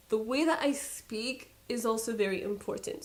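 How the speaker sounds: normal talking voice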